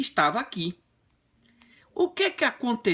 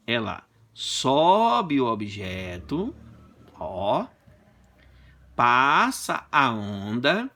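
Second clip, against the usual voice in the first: slow, drawn-out explaining; slow demonstration; 6 semitones lower, much slower than usual. Shown as a demonstration. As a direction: narrate the wave in slow motion. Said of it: "demonstrating wave slowly". (explicando devagar, bem arrastado)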